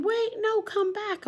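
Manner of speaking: very high pleading call. Acted. Duck calling Bear back, very high and excited. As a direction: beg him to come back. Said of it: "He's saying".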